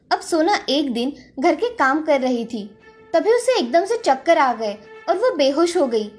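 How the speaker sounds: plain reading voice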